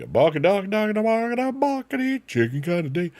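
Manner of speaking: rising high into a squeaky chant; silly high singsong. Pretend. Streamer goofing about a chicken day, high singsong.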